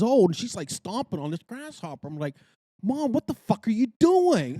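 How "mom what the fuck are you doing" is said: high, loud shocked yell; retold yell at mom. Yelling at her mother stomping a grasshopper, shocked. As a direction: blurt it out in horror. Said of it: "I swear I said that".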